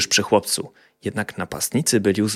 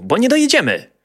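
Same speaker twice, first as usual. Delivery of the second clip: high, louder mock scolding; playful threat; 14 semitones higher, 4 dB louder than usual. A narrator's reading. Boy jokingly threatening the pony. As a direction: tease the pony with a fake threat. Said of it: "żartobliwie postraszył konia".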